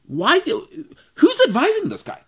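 who is advising him, really; incredulous. Real, not acted. Exasperated about Pedri's agent, a squeaky incredulous cry.

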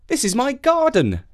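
put-on high young boy voice; proud little boy. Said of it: "said Jake".

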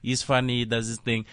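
Finnish MC introduction. Retold or imitated. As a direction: announce him as a Finnish host, building to a big finish. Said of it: "Then he goes on stage".

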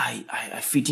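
breathy whisper. Real, not acted. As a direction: whisper it to yourself. Whispered aside.

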